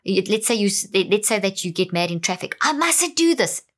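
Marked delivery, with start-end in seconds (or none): 2.6-3.6 anxious self-scolding. Shown as a demonstration. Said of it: "That will guarantee you will do it".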